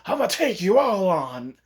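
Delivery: lightly hammed-up Naruto boast; brash challenge. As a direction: throw down a cocky challenge. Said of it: "He's not, you know".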